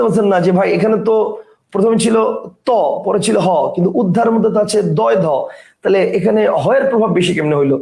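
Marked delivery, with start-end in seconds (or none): none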